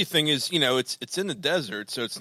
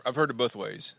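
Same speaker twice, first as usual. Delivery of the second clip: normal talking voice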